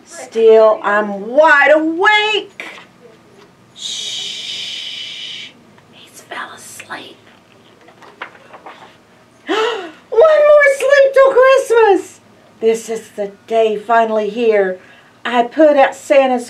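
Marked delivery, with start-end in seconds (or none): 3.7-7.2 hushed whisper; whisper, he's sleeping. Real, not acted. Reading, child asleep. 9.5-12.2 much higher, loud gasping shout of joy; excited shout. Acted. Refrain, climax.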